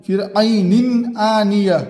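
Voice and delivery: slow, clear tanween before हमजा; slow clear reading of ऐनिन आनिया; izhar recitation. Shown as a demonstration. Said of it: "इजहार होगा".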